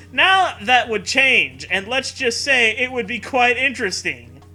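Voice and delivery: ordinary delivery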